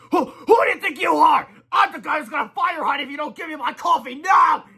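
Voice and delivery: very high, loud bossy yell; high shouted threat. Acted. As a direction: threaten like a tiny boss. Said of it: "yelled Melvin".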